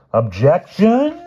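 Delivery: high, loud, drawn-out plea; imploring Hyde. A narrator's reading. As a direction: implore at the top of your register. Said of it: "Hyde implored".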